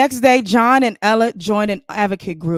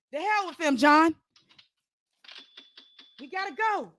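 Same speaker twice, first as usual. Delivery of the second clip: urgent wife; 7 semitones higher, 3 dB quieter than usual. Acted. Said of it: "Ella urges John to flee".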